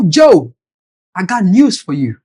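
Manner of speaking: ordinary talk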